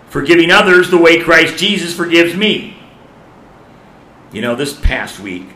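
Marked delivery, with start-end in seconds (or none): 0.1-2.8 higher and louder, driving the line home; emphatic repeat. Shown as a demonstration. Preacher repeating to congregation, louder emphatic restatement. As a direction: preach it harder the second time. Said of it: "preacher repeats the point".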